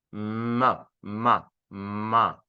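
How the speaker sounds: low "ma" with a held humming m; slow, separate "ma" syllables, m drawn out; voiced M demo. Shown as a demonstration. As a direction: hum into each "ma" so it vibrates.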